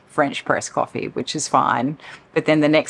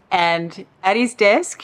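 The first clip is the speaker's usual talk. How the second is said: usual speaking voice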